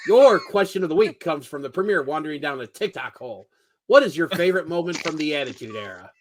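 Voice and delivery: ordinary talk, nothing marked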